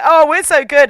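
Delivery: high, loud, self-satisfied crowing; mock boasting. Military bragging while failing, mock boastful. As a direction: crow like a smug navy, sending them up.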